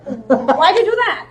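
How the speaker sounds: loud, drawn-out mock dismay; incredulous coach. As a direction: imitate a baffled coach, high and slow. Coach George's disbelief, retold.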